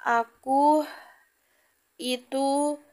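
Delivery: slow, halting, caught off guard; hesitant, startled stammering. A narrator's reading. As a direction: stumble on the name, then falter with long pauses.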